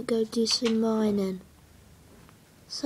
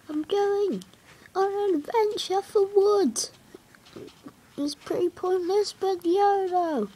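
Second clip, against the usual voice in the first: much higher playful voice; excited high voice; 9 semitones higher than usual. Real, not acted. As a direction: sing it out like a quest. Announces an adventure playfully.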